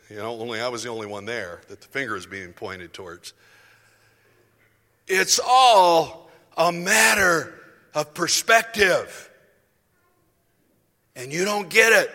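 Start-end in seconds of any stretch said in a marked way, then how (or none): 5.1-12.1 slow, booming, drawn-out rebuke, much louder; imitating a preacher's slow thundering rebuke; vociferous preacher. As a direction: stretch every word as you rebuke. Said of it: "very vociferous".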